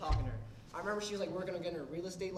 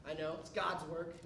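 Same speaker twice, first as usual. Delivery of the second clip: normal talking voice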